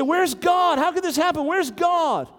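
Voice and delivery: high, pleading cry of where is God; anguished crying out. Retold or imitated. A disaster survivor crying out to God, high and anguished. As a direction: cry out to the sky in disbelief. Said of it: "and you say".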